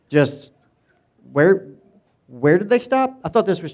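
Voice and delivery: a hammed-up gasp of surprise at the story; mock shock. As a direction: play scandalised disbelief, half-joking.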